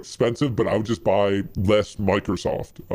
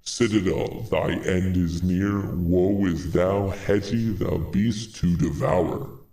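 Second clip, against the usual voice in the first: slow, measured ominous prophecy; understated doom pronouncement, words drawn out; scary voice; 3 semitones lower, slower than usual. Acted. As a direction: intone it slowly like a grim curse. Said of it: "How about this?".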